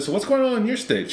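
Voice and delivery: high, falsely innocent question; mock sweet question. Husband needling the New York lady, high mock sweetness. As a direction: sweetly turn it back on her. Asking back, voiced high.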